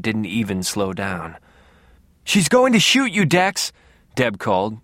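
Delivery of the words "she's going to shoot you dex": high, loud, panicky call; frantic warning. A narrator's reading. Sister warning her brother he'll be shot, frantic. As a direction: cry out in alarm, pitched way up. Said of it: "sounding somewhat frantic now".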